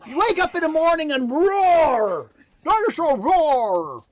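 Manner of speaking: loud, high hyped roar; dinosaur voice. Acted. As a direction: pump them up, roaring.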